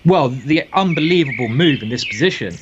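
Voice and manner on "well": normal talking voice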